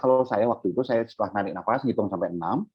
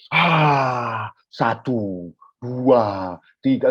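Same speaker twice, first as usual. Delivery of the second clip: exaggerated demo of counting aloud; how not to count; much slower than usual. Shown as a demonstration. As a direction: count aloud, stretching each number. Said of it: "jangan gitu".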